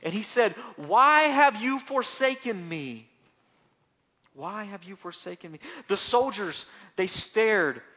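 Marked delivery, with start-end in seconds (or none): 0.8-3.1 high, loud, slow cry; dying man crying out to his God; anguished plea. Acted. Jesus crying out on cross. 4.3-5.6 much softer, fading plea; hushed repeat. Acted. Echoed softly after the cry.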